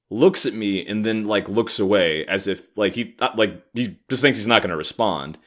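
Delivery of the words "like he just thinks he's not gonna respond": normal talking voice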